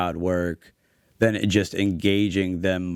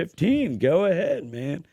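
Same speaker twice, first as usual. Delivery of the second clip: laid-back host voice; 8 semitones higher than usual. Retold or imitated. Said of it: "But he's like".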